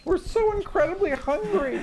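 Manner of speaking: very high squeaky creature plea; tiny baby owlbear voice. Acted. GM voicing hungry baby owlbears in a tiny squeaky plea. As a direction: squeak it like a starving baby animal.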